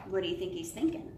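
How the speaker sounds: plain reading voice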